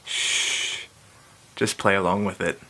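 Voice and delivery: whispered shh; keep-it-secret shush to the viewers. Real, not acted. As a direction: hush them conspiratorially.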